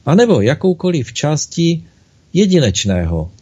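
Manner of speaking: usual speaking voice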